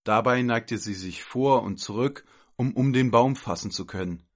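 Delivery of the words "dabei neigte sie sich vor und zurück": ordinary delivery, nothing marked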